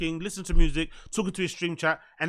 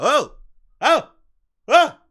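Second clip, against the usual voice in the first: mock "oh", rising higher each time; imitating dawning realisation; 7 semitones higher, 5 dB louder, slower than usual. Streamer mimicking the man thrown off by his looks, mock surprise. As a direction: mimic a baffled man's short, high exclamations. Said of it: "He's like".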